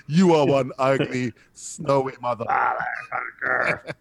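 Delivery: slow action-hero movie quote. Acted. Quoting the Predator movie.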